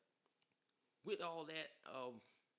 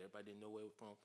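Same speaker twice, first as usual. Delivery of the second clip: usual speaking voice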